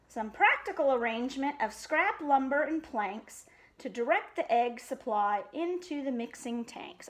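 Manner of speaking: usual speaking voice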